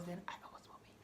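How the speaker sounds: breathy whisper, tossed off quietly; whispered aside. Real, not acted. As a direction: whisper it like a private confession. Whispered aside about being responsible.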